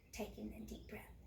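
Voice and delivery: ordinary delivery, nothing marked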